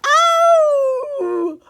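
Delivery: high, loud howl of pain. Acted. Wolf with his nose slammed in the door, howling. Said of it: "howled the wolf".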